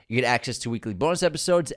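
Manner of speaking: ordinary talk, nothing marked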